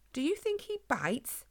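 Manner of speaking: high-pitched lady's wondering question. A narrator's reading. Margaret wonders about the hedgehog before her, wondering. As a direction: pitch it up, curious and wary. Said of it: "wondered Margaret".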